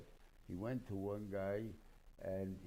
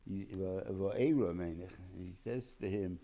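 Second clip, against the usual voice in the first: ordinary talk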